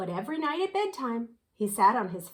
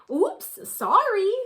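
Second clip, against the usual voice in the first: embarrassed oops; 10 semitones higher, 7 dB louder, slower than usual. Acted. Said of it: "she blushed".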